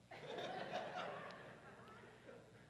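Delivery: soft airy chuckle; breathy laugh. Real, not acted. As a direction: laugh it off quietly. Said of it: "laughs at sweaty sweatshirt".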